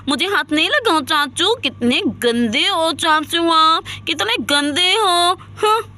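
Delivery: put-on sulky little kid scolding an uncle; huffy child's whine, drawing out the last words; childish protest. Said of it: "ये तो बिल्कुल बच्चा बन गई है". (बच्चों की तरह नाराज़ होकर)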